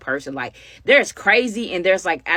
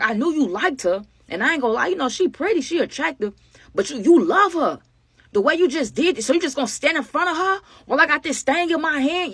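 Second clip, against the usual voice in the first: higher-pitched, incredulous needling; imitated taunt; 8 semitones higher than usual. Gunman to girl shielding her friend, imitated taunt. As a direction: play him amazed and mocking.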